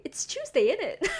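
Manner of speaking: hammed-up British accent, rising on the tag; mock British. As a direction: insist in cheeky mock British. Hams up Britishness as joke.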